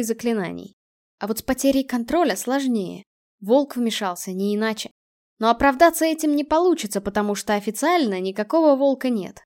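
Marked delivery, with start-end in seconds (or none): none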